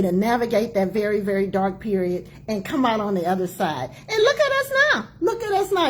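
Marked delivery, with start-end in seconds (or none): 4.1-5.9 excited high exclamation; high triumphant exclaim. Real, not acted. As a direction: pitch leaps up in joyful pride. Triumphant reflection.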